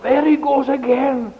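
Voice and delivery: high, whining grumble; complaining fire. Acted. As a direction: protest in a high voice at being blown on. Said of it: "it was complaining and saying".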